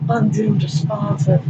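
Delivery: usual speaking voice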